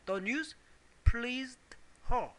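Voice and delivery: slow, separated words with pauses after "news" and "pleased"; measured classroom dictation of "The news pleased her". Shown as a demonstration. Teacher builds sentence word by word.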